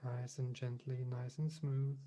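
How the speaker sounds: unhurried meditation voice with long pauses; guided meditation. Real, not acted. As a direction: gently guide them into calm, leaving space between phrases.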